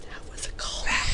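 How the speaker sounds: whispering to the camera; hushed conspiratorial whisper; whispered aside. Pretend. Nick Frost turns to camera.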